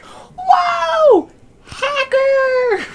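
high-pitched, full-volume shriek; huge piercing mock yell; mock alarm shout. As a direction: yell it out like a shocked gamer spotting a cheater. Mock alarm at a game number.

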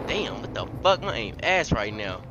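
ordinary delivery

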